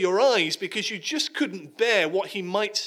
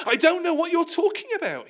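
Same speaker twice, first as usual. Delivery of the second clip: much higher, louder, all-out denial; vehement denial; 13 semitones higher, 7 dB louder, faster than usual. Acted. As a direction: throw yourself into the lie. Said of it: "he goes in with both feet".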